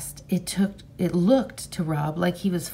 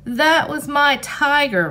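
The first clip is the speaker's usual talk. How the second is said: loud, high anguished scream; 9 semitones higher, 6 dB louder than usual. Acted. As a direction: scream at your father. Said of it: "Rob screamed".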